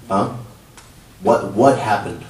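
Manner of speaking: normal talking voice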